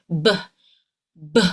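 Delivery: "B" as an isolated short "buh"; voiced B. Shown as a demonstration. Coach models the B, short and voiced. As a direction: pop "B" with lips together and voice on. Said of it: "it will vibrate here in your throat".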